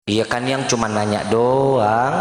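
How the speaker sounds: low, slow, gentle answer; calm him down gently. Retold or imitated. Wife soothing her angry husband, low slow gentle reply.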